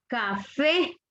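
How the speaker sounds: "café" with weight on "fé"; careful example word, "café" stressed at the end; stress on last syllable. Shown as a demonstration. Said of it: "fuerza de voz en la última sílaba".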